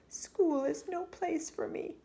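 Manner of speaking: put-on high, small girl's complaint; unhappy little girl. A narrator's reading. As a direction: sound like a hurt child. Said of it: "said Chrysanthemum".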